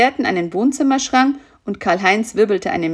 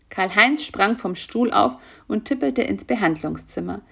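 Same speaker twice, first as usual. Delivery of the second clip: usual speaking voice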